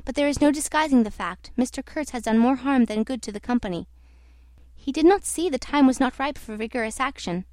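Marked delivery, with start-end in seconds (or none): none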